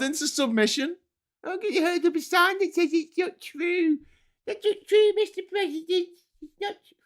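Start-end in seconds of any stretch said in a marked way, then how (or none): 1.4-6.9 high-pitched mocking little protester voice. Mimicking a protester's feeble sign, high-pitched mockery. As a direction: squeak out a feeble mock protest.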